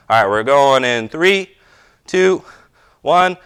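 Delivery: loud countdown call; barking rep counts. Real, not acted. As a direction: call the start sharp and loud. Coach starts the timed race.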